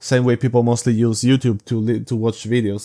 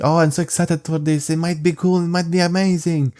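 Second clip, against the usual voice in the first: mock excited fan; 5 semitones higher than usual. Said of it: "They're being like".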